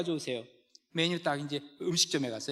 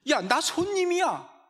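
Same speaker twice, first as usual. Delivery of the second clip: higher, louder, slow mock huff; indignant customer; 11 semitones higher, 6 dB louder, slower than usual. Pastor voicing rude customer, slow hammed-up indignation. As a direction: pull rank on the waiter.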